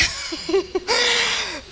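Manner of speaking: high, soft burst of laughter; real laugh. Real, not acted. Pastor laughs at being a patient who announced she was pastor, amused laugh. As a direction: laugh lightly at your own story.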